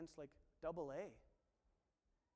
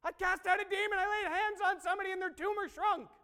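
very high, very loud excited squeal; hammed-up giddy shouting; giddy schoolgirl; 12 semitones higher, 15 dB louder than usual. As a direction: shriek it like a giddy little schoolgirl. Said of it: "come back all giddy like a little schoolgirl".

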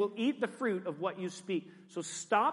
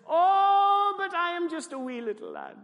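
Jeremiah; 11 semitones higher, 6 dB louder than usual. Acted. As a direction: wail the "Oh", then whimper like a Scottish lad. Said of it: "And Jeremiah says".

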